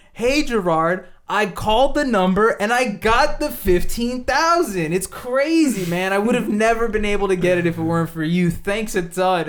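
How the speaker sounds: loud, high, overjoyed mock thanks; hammed-up thanks to the scammer; fake gratitude. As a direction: gush like you really got the money. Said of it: "I'm like".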